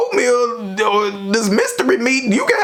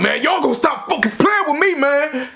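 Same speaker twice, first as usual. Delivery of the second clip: flipping out; 9 semitones higher than usual. Retold or imitated. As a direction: yell it like a friend losing his temper over jail food. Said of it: "he just flipped out".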